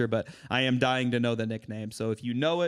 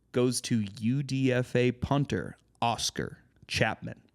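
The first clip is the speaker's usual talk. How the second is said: ordinary talk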